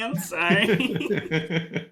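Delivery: slow, laughing apology; laughing too hard to talk. Real, not acted.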